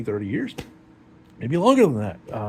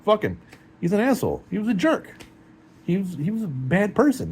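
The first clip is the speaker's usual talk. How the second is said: higher, bitter mock rant at a dead father; retold angry outburst; 6 semitones higher than usual. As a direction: spit it out like you still resent him.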